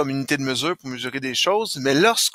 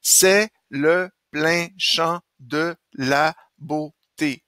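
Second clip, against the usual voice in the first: slow, evenly separated syllables; slower than usual. Shown as a demonstration. Teacher checking the verse makes eight, slow syllable-by-syllable reading. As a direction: count out each beat of the verse. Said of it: "si ça continue comme ça dans les prochains vers".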